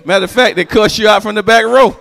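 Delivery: normal talking voice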